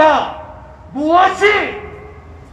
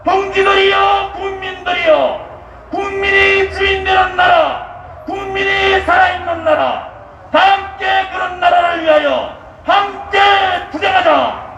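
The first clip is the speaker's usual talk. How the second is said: roaring rallying speech; 4 semitones higher than usual. Acted. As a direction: rouse the crowd like a rally leader. (군중을 선동하는 우렁찬 연설)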